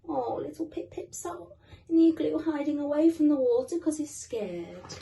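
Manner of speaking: ordinary delivery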